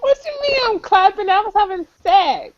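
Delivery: high, laughing protest. Real, not acted. Caller denies clapping, high laughing protest. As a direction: squeak it out through laughter.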